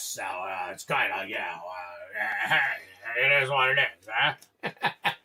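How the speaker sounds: a hint of a low croaky sick voice; imitating sick wife. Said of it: "she sounds kinda like this".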